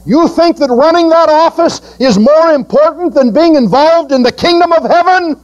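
high, driving preacher's rebuke; preaching at full force. Acted. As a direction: press them hard from the pulpit.

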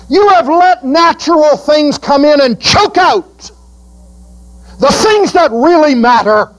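high, driving preacher's rebuke; preaching at full force. Acted. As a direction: press them hard from the pulpit.

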